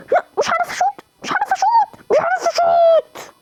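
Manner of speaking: very high whining, slowing down; high-pitched whimper of frustration; whiny frustrated. Real, not acted. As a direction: whine helplessly at the game. Gamer can't shoot, whining.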